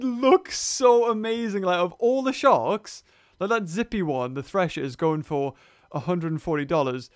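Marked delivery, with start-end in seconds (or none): none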